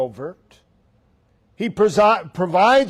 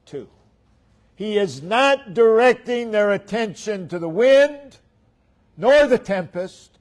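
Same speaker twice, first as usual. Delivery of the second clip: emphatic sermon delivery, each "nor" punched; forceful preaching; 4 semitones higher, 7 dB louder than usual. Real, not acted. Preacher telling where Isaiah directs attention, forceful preaching. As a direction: drive the list home like a preacher.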